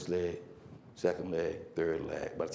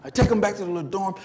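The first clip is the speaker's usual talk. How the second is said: ordinary talk, nothing marked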